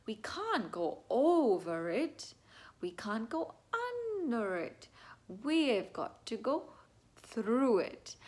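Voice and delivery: slow, drawn-out storybook delivery with dramatic pauses; playful sing-song for a little one; read-aloud for a child. Shown as a demonstration. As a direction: stretch and swoop on the key words, pausing before "through it". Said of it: "demonstrate this with my child by being like".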